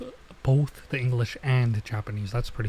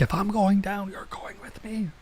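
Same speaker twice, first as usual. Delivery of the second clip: defiant last stand; 8 semitones higher, 4 dB quieter than usual. Acted. Character lashes out attacking him.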